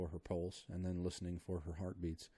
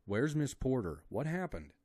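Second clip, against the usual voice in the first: urgent questioning; 7 semitones higher, 7 dB louder than usual. A narrator's reading. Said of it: "questioned Clayton".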